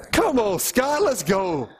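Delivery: high, eager coaxing; playful urging. Real, not acted. Preacher playfully urging Scott in the congregation to answer. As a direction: come on, coax Scott to answer.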